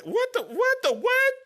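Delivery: high stammering mock shock; stunned disbelief. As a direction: sputter in disbelief. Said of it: "I was like".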